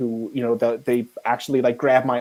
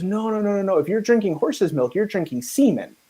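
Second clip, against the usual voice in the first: lightly raised, earnest explaining fixer; fixer's voice; 7 semitones higher than usual. Retold or imitated. Mongolian fixer convincing the crew, mock earnest. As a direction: play the fixer insisting straight-faced.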